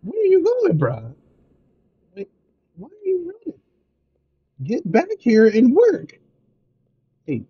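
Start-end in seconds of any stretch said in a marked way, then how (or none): 0.0-6.2 frantic high-pitched pleading; panicking gamer. Real, not acted. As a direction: squeak after him in a panic. Calling after fleeing worker.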